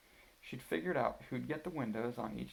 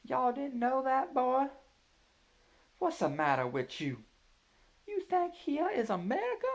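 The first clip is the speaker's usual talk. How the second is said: put-on hillbilly accent; 7 dB louder than usual. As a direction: play a mock Southern hillbilly teasing the kids. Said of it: "say some more Southern-style stuff".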